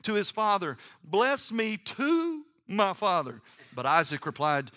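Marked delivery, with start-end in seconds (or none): 1.0-3.4 high, drawn-out narrated wail; bitter wail. A narrator's reading. Esau to his father on losing the blessing, wailing. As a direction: plead slowly, voice lifted in a mock wail. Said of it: "he wailed loudly and bitterly".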